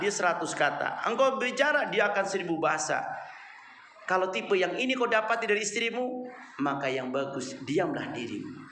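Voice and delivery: normal talking voice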